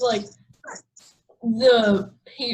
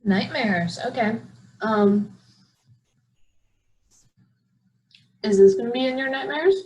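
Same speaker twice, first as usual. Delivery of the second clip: echo. Real, not acted. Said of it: "What's with the echo?".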